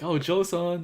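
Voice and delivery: high, loud imitation of a happy hello; delighted greeting. Idol recognising a returning fan, delighted. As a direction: pipe up as if greeting a favorite, with a smile. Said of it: "she started smiling".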